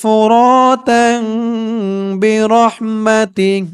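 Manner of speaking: drawn-out chanted syllables; slow recitation. Shown as a demonstration. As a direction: stretch each word slowly like recitation. Slow recited reading of the words. (ধীরে টেনে তিলাওয়াত)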